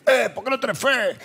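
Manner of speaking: lightly voiced judgmental churchgoer; harsh church scold. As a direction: parody a judgmental believer. Mimicking harsh church people.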